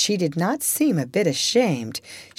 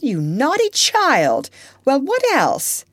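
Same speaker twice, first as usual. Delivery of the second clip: scolding; 10 semitones higher, 5 dB louder than usual. Acted. Aunt scolds confession.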